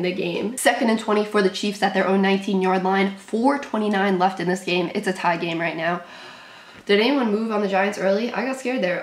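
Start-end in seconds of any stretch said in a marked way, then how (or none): none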